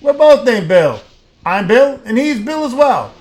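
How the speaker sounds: high, very loud shouted greeting; shouting. A narrator's reading. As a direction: bellow it as if deafened by foundry noise. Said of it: "He shouts".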